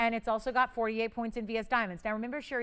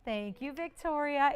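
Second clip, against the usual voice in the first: ordinary talk, nothing marked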